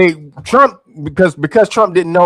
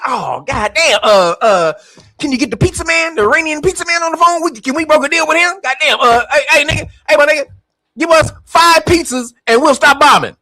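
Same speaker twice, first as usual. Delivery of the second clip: higher, whiny, fast begging; whiny Trump; 7 semitones higher than usual. Retold or imitated. Trump begging for the pizza man in a high whine.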